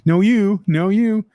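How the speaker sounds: high, lilting mock lovey phone goodbyes; phone goodbye back-and-forth. Host acts out not getting off the phone, high playful back-and-forth. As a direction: play both callers refusing to hang up, pitched high. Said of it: "like trying to get off the phone in the old days".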